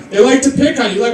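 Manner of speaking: usual speaking voice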